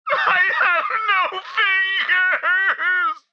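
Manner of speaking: drawn-out, high crying wail; tearful howl; despairing wail. Acted. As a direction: wail it slowly in hammed-up despair. Doomed follower wails.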